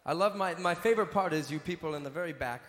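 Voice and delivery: ordinary delivery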